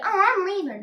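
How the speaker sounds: lightly acted indignation; mock huffy. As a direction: imitate an upset girlfriend walking out. Girlfriend storms out, acted quote.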